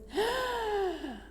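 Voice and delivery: high, drawn-out mock scream; stagey high-pitched wail, held back in volume. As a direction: shriek thinly on one falling note. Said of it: "gave a loud scream like this".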